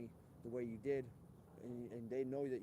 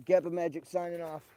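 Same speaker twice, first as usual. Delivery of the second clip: big loud sign-off, higher; sign-off announcer; 5 semitones higher, 13 dB louder than usual. Acted. Host signing off the stream like an announcer. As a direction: announce the channel name with flair.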